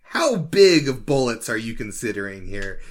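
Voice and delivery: ordinary talk, nothing marked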